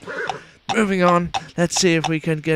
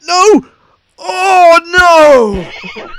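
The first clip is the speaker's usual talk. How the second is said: anguished shout; 12 dB louder, much slower than usual. Pretend. Climb fails, anguished commentator.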